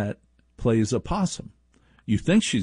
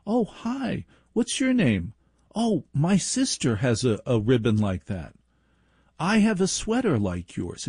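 put-on girl introducing herself to new kids; friendly girl. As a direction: chirp it like a friendly kid. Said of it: "she'd go into the circle of kids and go".

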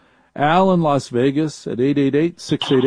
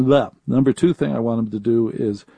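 ordinary talk, nothing marked

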